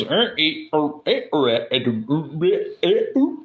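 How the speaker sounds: choppy robotic squeaks, jumping up high at the end; mock glitchy squiggle noises; imitating audio glitches. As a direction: mimic a breaking internet connection. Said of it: "All I heard was".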